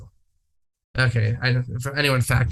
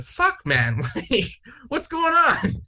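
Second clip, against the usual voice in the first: laughing disbelief; 11 semitones higher, slower than usual. Retold or imitated. Stunned at getting a ticket.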